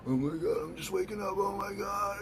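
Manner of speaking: high, drowsy put-on voice of someone just waking; groggy waking-up voice. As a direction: whine it like you just rolled out of bed. People groggily waking in the morning.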